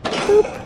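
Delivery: high unvoiced little boop; playful boop. Real, not acted. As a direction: pop it out like a toy. Playful sound effect.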